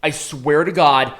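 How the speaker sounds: ordinary delivery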